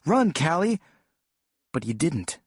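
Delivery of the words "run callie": urgent high shout to his sister; yell. Retold or imitated. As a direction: yell a warning across the room. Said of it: "and I yelled".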